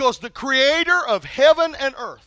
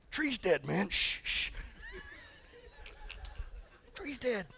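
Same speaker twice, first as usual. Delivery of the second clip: hushed aside; 7 semitones higher, 17 dB quieter, slower than usual. Retold or imitated. Whispering to a friend.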